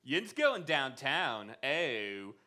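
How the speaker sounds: slow, drawn-out Pittsburgh accent; exaggerated Pittsburgh accent. Shown as a demonstration. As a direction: put on a bad Pittsburgh accent, stretching the words. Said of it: "the Pittsburgh accent".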